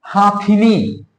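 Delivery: हाथिनी drawn out slowly with a long हा; wrong pronunciation. Shown as a demonstration. Teacher reading out the misspelt word, slow wrong pronunciation. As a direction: stretch the first आ of हाथिनी. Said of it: "हाथिनी नहीं होता भैया".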